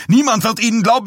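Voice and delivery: hoher, lauter, schneller Wutschrei auf Niemand; überschlägt sich bei Niemand; wütend schreiend. A narrator's reading. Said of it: "Dies stotterte wütend". (hoch, laut und schnell, Betonung auf Niemand)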